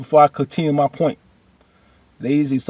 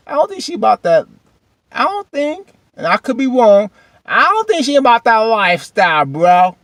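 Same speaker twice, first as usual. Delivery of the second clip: loud, high teasing objection; high-pitched doubt; 9 semitones higher, 6 dB louder than usual. Acted. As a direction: argue it from the top of your range. Argues with Isaiah in acted reply.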